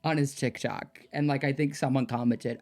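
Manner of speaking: ordinary talk, nothing marked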